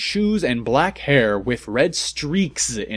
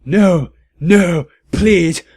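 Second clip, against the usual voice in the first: pleading, louder and higher; a mock-desperate plea for mercy; begging; 6 semitones higher, 7 dB louder, much slower than usual. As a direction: beg him not to kill you. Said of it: "we begged sadly".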